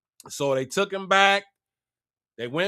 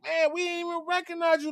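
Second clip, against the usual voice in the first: high, softer, quick amazement; disbelieving brothers; 10 semitones higher, 5 dB quieter, faster than usual. Retold or imitated. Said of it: "they can't believe it".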